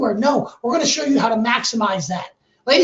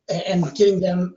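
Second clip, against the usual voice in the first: normal talking voice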